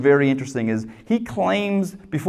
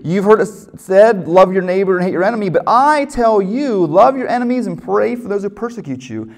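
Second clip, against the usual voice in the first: preacher punching "I" in "but I tell you"; stress on I; 4 semitones higher, 5 dB louder than usual. Shown as a demonstration. Preacher quoting Jesus again.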